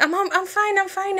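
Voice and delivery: faint, stammering reassurance; weak and dazed. Retold or imitated. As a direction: sound woozy after fainting. Weak dazed self-quote, very soft.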